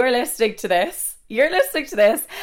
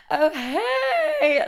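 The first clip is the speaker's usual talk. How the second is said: mock drunk; 8 semitones higher than usual. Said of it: "acting like you're shit-faced".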